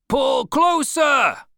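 high, loud, stretched yell; cried out. A narrator's reading. Boat leader as the whale weakens, shouting. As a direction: bellow it out to the rowers. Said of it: "cried Stubb".

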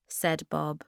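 usual speaking voice

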